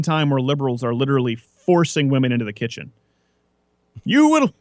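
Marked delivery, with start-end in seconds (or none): 4.0-4.5 high, loud dictator bark; mock commanding shout. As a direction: order it like a tyrant.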